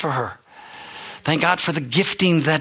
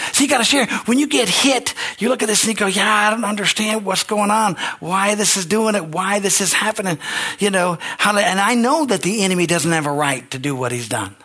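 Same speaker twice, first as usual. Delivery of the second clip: rising preaching; 6 semitones higher than usual. Acted. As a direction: lift the congregation with rising pitch. Preaching rise to congregation.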